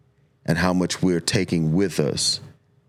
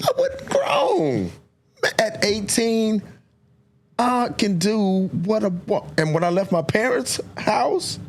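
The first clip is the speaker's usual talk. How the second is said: put-on much higher, strained, cocky young man; cocky teenager. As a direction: squeak it out like a know-it-all teen. Mocking his cocky eighteen-year-old self.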